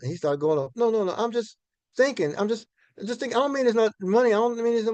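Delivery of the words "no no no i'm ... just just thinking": ordinary talk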